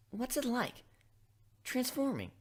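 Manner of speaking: high, eager question; lightly voiced curious pony; curious Twilight. Twilight asking Griff about transforming, high and curious. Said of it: "Twilight asked".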